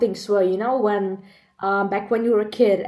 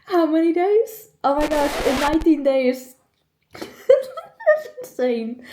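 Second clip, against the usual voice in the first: laughing; 7 semitones higher than usual. Real, not acted. Laughing at own upcoming birthday.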